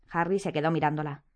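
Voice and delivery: ordinary delivery, nothing marked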